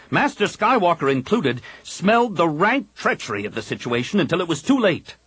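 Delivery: Kip's fervent voice. A narrator's reading. Kip urging the Jedi council to fight, insistent. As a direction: preach the fight, every word pressed.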